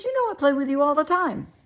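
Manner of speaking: high, loud imitated self-defence; protesting excuse. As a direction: plead your case loudly, up high. Acted excuse in the quarrel.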